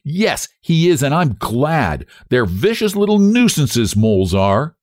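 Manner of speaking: high-pitched, indignant outburst; wrathful. A narrator's reading. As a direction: declare it with vindictive satisfaction. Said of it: "With which her wrath vanished".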